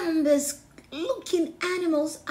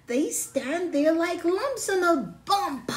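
mock the dumb pigs, pitched up; higher mocking; 7 semitones higher, 4 dB louder than usual. Megan sneering at the pigs, higher and louder. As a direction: taunt them with scorn, high and loud.

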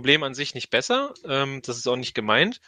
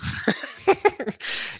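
breathy laugh; 12 semitones higher, 3 dB quieter than usual. Real, not acted. Reacts to partner chewing candy.

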